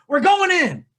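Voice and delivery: rally the troops as dad; mock rallying cry. Dad leading the family in, mock rallying cry. Dad's bold battle cry, retold.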